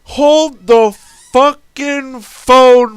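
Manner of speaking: slow, loud, drawn-out disbelief; incredulous exclamation. Retold or imitated. Reacting at the table to the resurrection, slow loud disbelief. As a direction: stop everything, stunned. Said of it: "I'm like".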